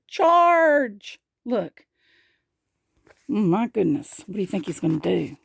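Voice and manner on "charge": high, drawn-out battle cry; mock bellow, high and stretched. A narrator's reading. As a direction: call the charge in a high voice.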